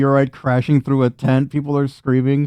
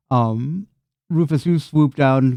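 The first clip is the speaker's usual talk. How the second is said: normal talking voice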